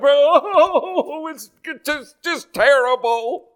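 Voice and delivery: high, laughing mock lament. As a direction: play the self-pitying moaner. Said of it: "instead of a".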